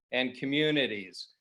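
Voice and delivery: ordinary talk, nothing marked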